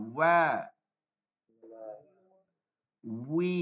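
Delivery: ওয়া উই উ spaced out for students to repeat; slow call and response. Shown as a demonstration. Teacher leads students in repeating, slow and spaced. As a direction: lead the class, long gaps to echo. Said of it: "আমার সাথে সাথে বলেন".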